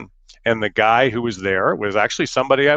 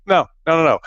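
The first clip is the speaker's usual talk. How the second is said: higher, rapid string of noes; emphatic denial; 7 semitones higher, 4 dB louder, faster than usual. Retold or imitated. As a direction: cut off the hopeful thought. Comic denial of his hope.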